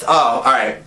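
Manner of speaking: usual speaking voice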